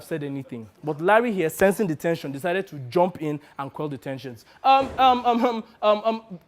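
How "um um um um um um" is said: higher, stammering ums; flustered attempt to get attention; nervous interjection. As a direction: hesitantly butt in on a quarrel. Larry nervously interrupts the quarrel.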